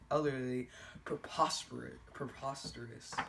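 trailing off to a murmur; fading into quiet. Pretend. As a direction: let it die away in disbelief. Reader fades out in disbelief.

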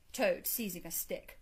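ordinary delivery

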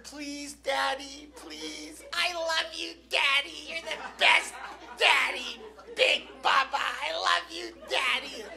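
put-on little-girl pleading, drawn-out and singsong; mock a child wheedling her father; imitated begging daughter.

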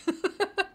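high, sheepish giggle; real high-pitched chuckle; apologetic laugh. Real, not acted. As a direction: giggle helplessly after saying sorry. Laughs after apologising for her accent.

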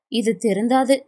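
plain reading voice

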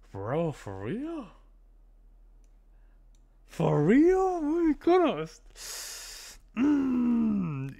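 stretched high disbelief, slow; drawn-out shocked "oh my goodness"; incredulous. Real, not acted. Disbelief at the clip.

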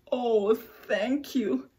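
slow, worn-out thank you; weary and soaked, barely grateful; tired ladybug voice. Acted. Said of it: "said the wet, tired, and hungry ladybug".

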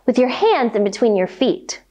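ordinary delivery, nothing marked

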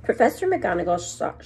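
usual speaking voice

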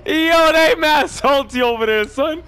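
high, loud, slow gloat. Real, not acted. Gamer gloating to his squad, excited and loud. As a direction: savor their defeat. Gloating to friends after the win.

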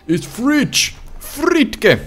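high cartoonish squeal of fridge; funny squeaky voice. Gamer squealing at a fridge in a funny voice.

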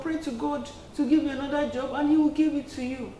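put-on child gently begging parents to change jobs, softly; gentle pleading. Shown as a demonstration. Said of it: "Say it nicely. Say it gently".

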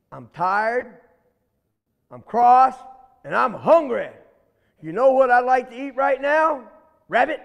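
loud, growing menace; cross alligator. Acted. Alligator threatening the little rabbit, loud and cross. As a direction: build up the threat.

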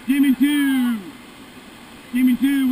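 loud, firm paddle call; shouted command. Real, not acted. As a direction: call it out to the crew. Guide calling strokes near friend.

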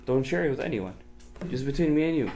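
soft, secretive aside; leaning in to whisper. Acted. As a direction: confide it softly. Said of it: "can I tell you a secret".

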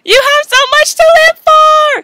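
high loud pleading shout; melodramatic plea. Acted. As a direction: beg dramatically.

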